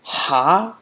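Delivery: ha breathed hard from the throat; strong ha. Shown as a demonstration. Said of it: "the really strong ha".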